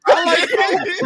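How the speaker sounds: laughing. Real, not acted. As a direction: stretch it out through laughter. Laughing, drawn out.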